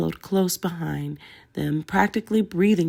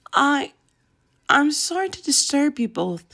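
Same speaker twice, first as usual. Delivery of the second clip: higher, halting nervous stammer; stuttered apology; 9 semitones higher than usual. A narrator's reading. Nervous Hermione stammers an apology to grieving couple. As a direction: stumble over the words, nervous. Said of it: "she stuttered out eventually".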